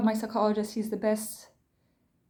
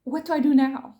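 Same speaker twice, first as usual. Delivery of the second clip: higher, louder retold plea, no real sobs; mock-desperate, raised voice; retold tearful panic; 4 semitones higher, 7 dB louder than usual. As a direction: imitate a panicked cry for help. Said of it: "I was crying, sobbing, like".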